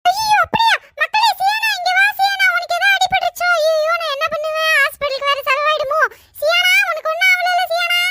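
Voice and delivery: put-on high, fretting mother fussing over a hurt child; mock panic.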